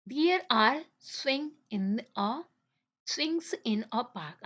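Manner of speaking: very slow, word-by-word read-aloud; teacher reading a sentence very slowly to the class; slow dictation. Shown as a demonstration. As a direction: spell it out slowly for young students.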